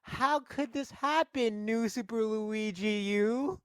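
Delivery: high, drawn-out mock-distressed princess; Peach. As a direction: wail slowly like a melodramatic heroine. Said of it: "And Peach is like".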